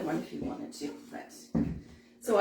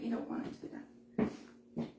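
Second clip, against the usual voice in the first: quiet aside; 10 dB quieter than usual. Real, not acted. Aside about petal shape.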